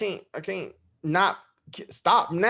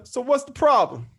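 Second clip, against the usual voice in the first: higher, louder mock challenge; dad's punchline; 8 semitones higher, 5 dB louder than usual. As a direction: deliver the punchline with a nudge. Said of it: "pops hit me on the shoulder".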